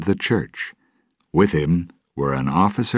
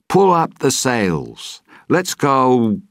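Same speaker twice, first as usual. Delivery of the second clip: higher, louder, slow captain's order; loud command; 9 semitones higher, 5 dB louder, slower than usual. Acted. Jack ordering his crew to sail, loud command. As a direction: call it across the deck.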